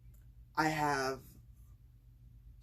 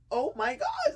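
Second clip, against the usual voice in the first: squealed oh my gosh; 6 dB louder than usual. Retold or imitated. Retelling her excited reaction.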